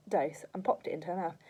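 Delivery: ordinary delivery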